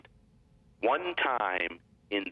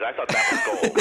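laughing; 12 semitones higher, 7 dB louder than usual. Real, not acted. Laughing at own joke.

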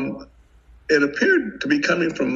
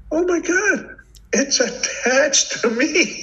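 high, stretched disbelief; shocked realization; 13 semitones higher, slower than usual. Retold or imitated. Wounded man realizing his hand is his own, shocked. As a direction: voice shoots up in alarm. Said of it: "And I'm thinking".

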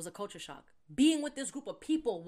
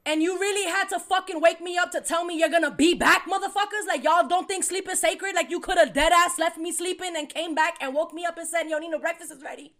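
high, loud irritated rant; annoyed rant; 8 semitones higher, 11 dB louder than usual. Retold or imitated. Ranting at roommates who woke her, high and loud. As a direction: vent hard at being woken. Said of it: "so I was like".

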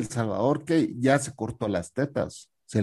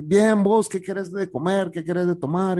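high, eager host voice; Ana's hearty welcome; 7 semitones higher than usual. Retold or imitated. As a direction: offer food like a beaming host. Ana offers food, voiced. (anfitriona alegre y aguda)

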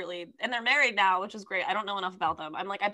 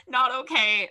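normal talking voice

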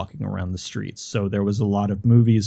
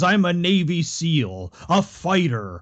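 higher, hammed-up tough-guy brag; mock-heroic boast; 7 semitones higher, slower than usual. Guest jokingly dodging the host's plug question, mock-heroic. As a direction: declare it like a joke action hero.

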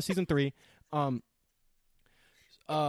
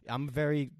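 ordinary delivery

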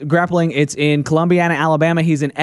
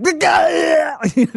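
high, strangled yelp while being smashed; imitated uncontrollable noise; 9 semitones higher than usual. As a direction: let out an involuntary crushed cry. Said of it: "these kind of uncontrollable noises".

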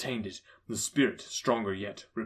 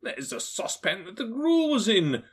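higher, eager exclaim; excited Scrooge; 8 semitones higher than usual. A narrator's reading. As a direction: point round the room gleefully. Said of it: "cried Scrooge".

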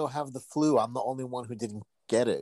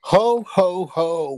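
jolly ho ho ho; 5 semitones higher, 7 dB louder, much slower than usual. Pretend. Santa-style holiday greeting.

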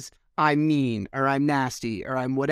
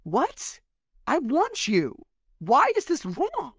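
rising higher, pleading and bewildered; a slow, high final why; exasperated plea; 9 semitones higher, slower than usual. Retold or imitated. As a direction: beg to be understood, voice climbing high. Pleading with partner in retold argument.

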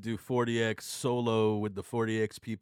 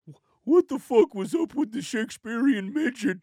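imitating Nikki; 8 semitones higher, 4 dB louder than usual. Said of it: "he just said it in such a Nikki way".

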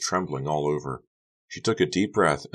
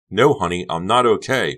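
loud, raised, forceful denial; angry cry; 4 semitones higher, 8 dB louder than usual. A narrator's reading. Becca stepping angrily toward Honey, angry cry. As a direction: cry it out at full tilt. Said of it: "Becca cried".

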